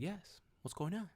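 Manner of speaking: high-pitched cheerful answer; really happy. Retold or imitated. Patient answering the specialist's call, happy and high-pitched. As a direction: chirp it, happy and friendly. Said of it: "Really happy, like I normally am".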